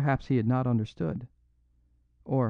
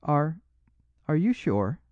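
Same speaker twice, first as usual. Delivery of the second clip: higher, hesitant question; timid eagerness; 5 semitones higher than usual. A narrator's reading. Small priest questioning the robber, timidly eager. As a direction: ask timidly but eagerly. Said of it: "with the timid eagerness of the private secretary".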